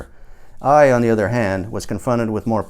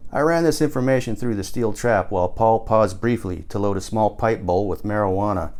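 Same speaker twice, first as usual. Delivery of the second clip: ordinary delivery, nothing marked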